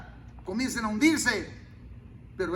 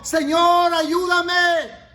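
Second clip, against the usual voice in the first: higher, louder shouted plea; desperate shout; 7 semitones higher, 10 dB louder, much slower than usual. Retold or imitated. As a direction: cry out to God with everything. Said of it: "un clamor desesperado". (clamor desesperado, gritado y agudo)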